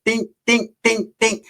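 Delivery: high, light ding ding imitation; ding sounds. In the bathroom stalling, high mock ding noises. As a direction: ring it like a little bell. Imitating pee hitting bowl.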